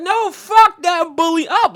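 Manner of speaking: high, loud, slow cheer. Retold or imitated. Cheering Michael on against the bully, loud and high. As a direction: yell it slowly like a cheering fan. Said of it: "I'd be like".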